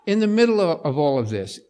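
ordinary delivery

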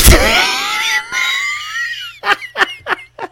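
squealing laugh bursting out; cracking up mid-line; stifled giggles. Real, not acted. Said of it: "I'm gonna try it one more time".

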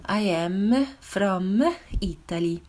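put-on very slow Italian accent with small vowels after "am" and "from"; Italian-accented English. Teacher imitates Italians speaking English, slow Italian accent with added final vowels. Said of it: "hører italienere sige på engelsk".

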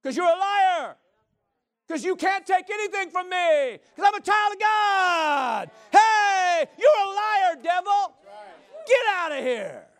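high, loud, drawn-out preacher's shout; shouted rebuke. Acted. Child of God throwing the devil out, full-force shout. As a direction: yell it in defiance.

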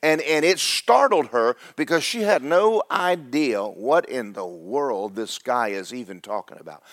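usual speaking voice

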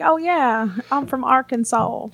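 attempted Southern accent, drawn out; put-on Southern accent. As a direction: try on an Arkansas accent, stretching the last word. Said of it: "develop an accent like y'all".

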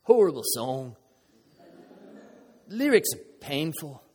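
mock English accent, lightly done; a quick hammed-up English accent. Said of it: "let me do his English accent".

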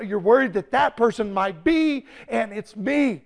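loud, urgent sermon story; fervent storytelling. Acted. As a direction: build tension for the congregation. Preaching story, raised voice.

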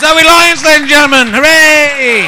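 loud, high and rousing: "Zoe Lyons, ladies and gentlemen. Hooray!"; host's cheer. Real, not acted. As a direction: whip the crowd up as the act leaves the stage. Host cheering the act off.

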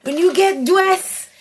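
high, slow Haitian mom impression; drawn-out Haitian-accented mom voice; heavy Haitian accent. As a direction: coach your daughter as a Haitian mother. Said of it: "in her very heavy Haitian accent".

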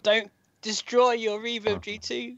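high, slow mock plea; mock pleading voice. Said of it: "I was like".

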